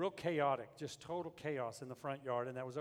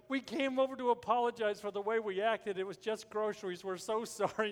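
high, louder retold apology; urgent, raised-pitch mock pleading; frantic apology; 6 semitones higher, 5 dB louder than usual. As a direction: act out a flustered neighbor apologizing. Neighbor's frantic apology after bloody fall.

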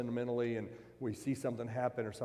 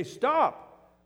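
sudden loud high stop; commanding shout; 10 dB louder than usual. Acted. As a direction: halt them with one sharp shouted word. Paul halts worshipping crowd.

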